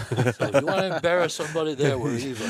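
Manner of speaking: laughing through the punchline. Real, not acted. Storyteller laughing while delivering the punchline. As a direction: let the laugh carry the words.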